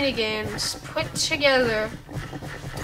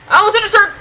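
high, loud near-miss cry; alarmed shout; 8 semitones higher, 8 dB louder, much faster than usual. Real, not acted. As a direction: yelp it out after a close call. Dodging obstacles in boat race.

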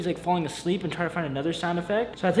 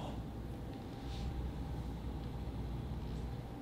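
whispered gasp; 15 dB quieter than usual. Real, not acted. Sneaking to friend's door.

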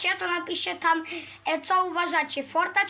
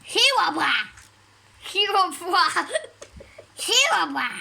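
possessed; 4 dB louder than usual. Retold or imitated. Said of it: "co ten Wiktor opętany mówi".

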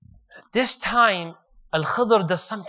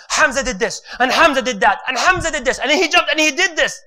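imitating his own hyped battle telling; hyped storytelling; 6 semitones higher, 5 dB louder than usual. He retells his own lecture on Uhud as hyped storytelling. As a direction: pump it up like the battle's right there. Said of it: "all hyped up".

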